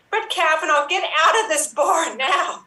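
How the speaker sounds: loud, high, slowed eviction call through laughter; laughing mock order. Bartender ordering Brett Kavanaugh out, loud with laughter. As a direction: throw him out of the bar, half laughing.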